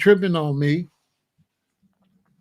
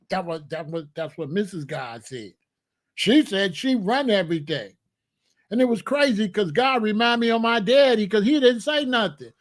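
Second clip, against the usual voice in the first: ordinary delivery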